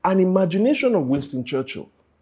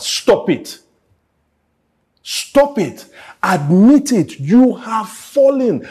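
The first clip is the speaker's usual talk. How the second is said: louder, slow, firm rebuke; stern rebuke; 5 semitones higher, 7 dB louder, much slower than usual. Acted. Preacher rebuking the congregation, loud and stern. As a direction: call them out hard, slowly.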